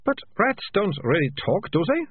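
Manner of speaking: put-on girl unable to believe talking rats; bewildered. A narrator's reading. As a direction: ask it lost and baffled. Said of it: "now quite bewildered".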